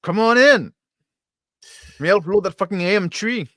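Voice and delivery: high, loud in-character call; yelling. Acted. Player calling Brick to help load, yelling. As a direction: holler for a crewmate. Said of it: "I yell".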